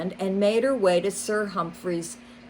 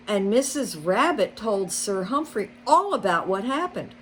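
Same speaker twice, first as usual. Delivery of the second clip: ordinary delivery, nothing marked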